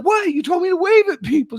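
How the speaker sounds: high, loud protest; indignant teen. Retold or imitated. Son protesting to his mom he was told to wave, high loud indignant. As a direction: defend yourself like a wronged kid. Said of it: "He's like".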